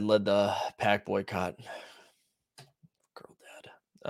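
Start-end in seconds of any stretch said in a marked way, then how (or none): 3.1-4.1 whispered name aside; soft whispered aside. Real, not acted. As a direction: breathe the name like a secret. Hushed aside naming a user.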